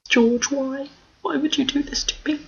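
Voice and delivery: high, soft mock despair; mock anguished wail. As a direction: whine it like heartbroken. Mock despair at character's fate.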